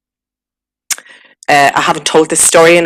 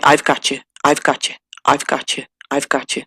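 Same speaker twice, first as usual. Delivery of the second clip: softer each time until nearly gone; a gentle reassurance fading into silence; quiet repeated comfort that dies away; soothing voice; 7 dB quieter than usual. Retold or imitated. Comforting voice heard in vision.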